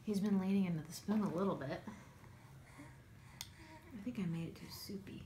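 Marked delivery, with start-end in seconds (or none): 3.4-5.2 whispering. Real, not acted. Aside while feeding baby.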